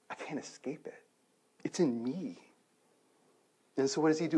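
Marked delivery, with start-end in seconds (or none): 0.1-2.4 hushed, almost whispered inner thought; hushed realization. Retold or imitated. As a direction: drop to a near-whisper, as if admitting it privately. Voicing George's dawning inner dread.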